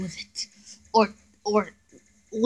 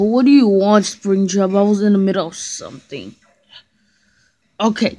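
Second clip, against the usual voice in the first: ordinary talk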